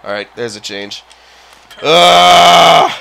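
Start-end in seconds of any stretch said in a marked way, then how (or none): 1.8-2.9 loud shouted ugh of frustration; loud fed-up groan; frustrated groan. Real, not acted. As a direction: let out a loud angry grunt. Frustrated by missed chance.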